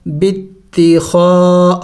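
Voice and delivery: बित्ती खा with खा stretched for one alif; long खा. Shown as a demonstration. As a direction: draw out the खा, slow. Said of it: "खा को लंबा करेंगे". (बित्ती खा में खा को एक अलिफ़ लंबा खींचें)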